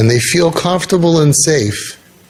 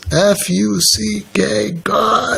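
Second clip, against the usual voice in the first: imitated cursing; 6 semitones higher, 3 dB quieter, much slower than usual. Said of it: "he was cursing God".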